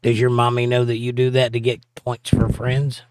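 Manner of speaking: ordinary delivery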